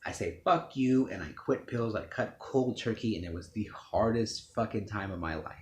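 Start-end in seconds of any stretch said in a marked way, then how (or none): 0.4-1.1 defiant retort. Retold or imitated. Refusing mom's demand.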